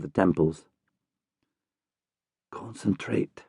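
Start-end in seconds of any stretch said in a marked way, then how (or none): 2.5-3.4 hushed whisper to himself; whispered self-command. A narrator's reading. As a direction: breathe the word inward, steadying. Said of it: "he whispers to himself".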